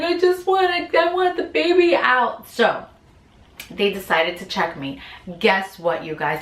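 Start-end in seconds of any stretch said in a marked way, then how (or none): 0.0-2.5 desperate plea. Retold or imitated. In pain, begging for delivery.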